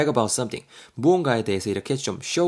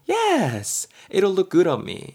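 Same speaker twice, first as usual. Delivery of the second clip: high, loud, smug reply; cocky B voice; 9 semitones higher, 4 dB louder, much slower than usual. Acted. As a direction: brag like a vain friend. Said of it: "B가 뭐라 그래요". (높고 우쭐한 B 목소리)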